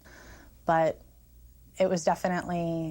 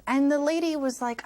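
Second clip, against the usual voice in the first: ordinary talk, nothing marked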